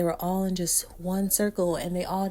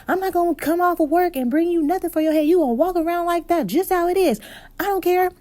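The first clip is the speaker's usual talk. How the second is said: high, loud, fast angry rant; yelling mom; 12 semitones higher, 10 dB louder, faster than usual. Retold or imitated. Mom on the phone refusing to come, loud angry rant. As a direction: mom refusing to leave work, high loud furious yell. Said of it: "my mom's like".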